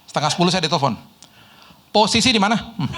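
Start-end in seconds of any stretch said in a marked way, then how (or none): 1.9-2.5 higher, mock-stern phone demand; comic imitation of a scolding housekeeper; imitating bossy maid. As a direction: demand it like a fierce maid checking up. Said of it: "Dia galak sama saya".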